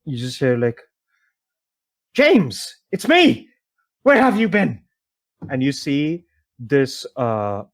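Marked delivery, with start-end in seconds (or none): 2.1-4.8 high, loud, eager call; elvish woman calling. Acted. Elvish woman greets a man long missing, calling out. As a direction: raise the pitch and hail a lost partner. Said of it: "elvish woman walks up to Dagon".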